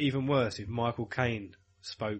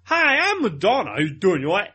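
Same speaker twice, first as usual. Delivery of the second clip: put-on [name]'s weird American-English accent; 5 semitones higher, 6 dB louder than usual. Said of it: "she's got a weird American English accent".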